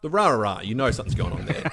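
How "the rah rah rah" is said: imitating rah rah. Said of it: "the rah, rah, rah's a dead giveaway".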